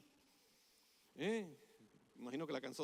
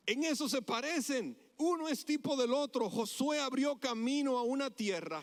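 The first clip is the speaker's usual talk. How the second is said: high, raised, driving sermon delivery; impassioned preaching; 5 semitones higher, 4 dB louder than usual. Real, not acted. Preacher likening Joshua to Jesus, impassioned preaching. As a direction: build the comparison, then settle at the end.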